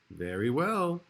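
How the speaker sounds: ordinary delivery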